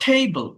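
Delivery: Table with the first syllable punched; stress on first syllable. Shown as a demonstration. Teacher demonstrating word stress, table stressed on the first syllable. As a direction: hit the opening of table, let the end drop. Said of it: "first syllable stressed".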